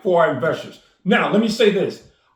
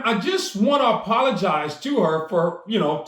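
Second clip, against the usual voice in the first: ordinary talk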